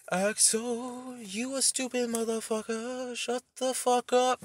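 high, slow, drawn-out put-on voice; hammed-up speech, long held vowels; mock speech voice. Shown as a demonstration. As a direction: stretch every word out in a high mocking singsong. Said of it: "I'll be like this".

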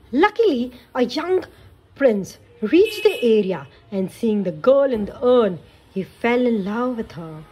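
put-on lively read-aloud for kids; storybook narration. A narrator's reading. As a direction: tell it like a bedtime story. Narration continues.

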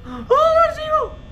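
very high cry of dread; loud, high-pitched alarm; panicked shriek. Real, not acted. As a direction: shriek as all hope goes. Power runs out.

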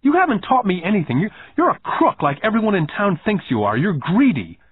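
put-on high-pitched accusations from a wronged little boy; acted child's heated tirade; indignant outburst. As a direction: pile up the grievances, worked up. Boy accusing boss in acted quarrel.

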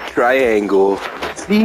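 usual speaking voice

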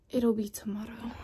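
whispering. Real, not acted. Late night whisper sign-off.

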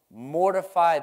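loud, punched MORTIFY, pitched high; booming pulpit attack on the word; forceful scripture reading. Acted. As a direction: hit "Mortify" hard like a command. Opens the verse with force.

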